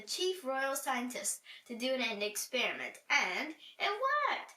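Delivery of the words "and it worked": high, slow, triumphant exclaim; a gleeful reveal, much higher; excited. Acted. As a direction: announce the success with delight. Triumph of the experiment.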